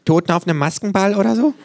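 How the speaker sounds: high, loud, quick quip; kangaroo voice. A narrator's reading. Kangaroo teasing the masked patient, high quick jibe. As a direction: pipe up fast as the kangaroo.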